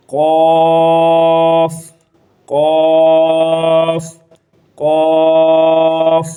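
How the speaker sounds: قاف said thick from the back of the throat; heavy letter sound. Shown as a demonstration. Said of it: "اس کو موٹا پڑھیں گے".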